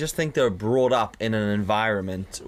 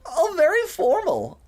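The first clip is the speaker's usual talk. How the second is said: high, slow sneering voice; a rude customer mocking his politeness; mocking posh customer; 5 dB louder, much slower than usual. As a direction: sneer it back like a snooty customer. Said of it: "And they were like".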